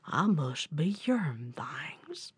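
low, soft bear mumble; slow-witted old bear. Acted. Puzzled bear shrugs to himself, low soft voice. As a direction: rumble it low and quiet, as if to himself. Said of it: "he shrugged his shoulders and he said".

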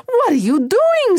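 high, loud indignant mother; acted high-pitched scolding; outraged Mama. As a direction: screech it like an appalled mama. Startled Mama confronts her son.

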